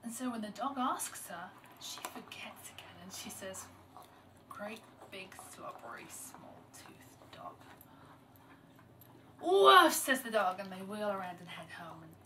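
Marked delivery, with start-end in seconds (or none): 4.5-7.5 slow, whispered grumble; hushed muttering to oneself; mumbled under breath. A narrator's reading. Girl lets the insult slip again. 9.4-9.9 loud, high barked woof; sudden storyteller's dog bark; dog's woof. A narrator's reading. Offended dog barks in the story.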